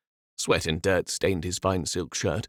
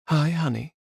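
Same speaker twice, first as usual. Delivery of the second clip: flirty; 9 semitones higher, 3 dB louder than usual. Acted. Morel greets Renaud flirtatiously.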